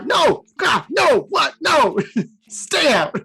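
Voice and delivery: high, frantic no; panicked protest. Acted. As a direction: push the voice out in alarm. Jace panics at Emrakul's voice.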